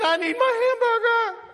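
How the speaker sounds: very high mock crying wail; bawling child. Child bawling in the back seat over lunch, mock crying. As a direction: wail like a little kid in hammed-up tears. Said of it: "they're just bawling their eyes out".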